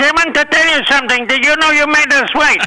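high-pitched mock Hervé telling off; squeaky little-man reprimand; imitating Hervé scolding. Hervé scolds late Shecky, high put-on voice. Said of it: "He said".

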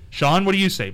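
usual speaking voice

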